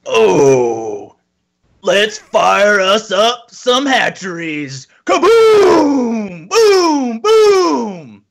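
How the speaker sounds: high, slow, excited soldier voice building to yells; marauder impression. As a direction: ham up a gung-ho space marine, then shout the booms. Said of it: "do your best impression of a marauder".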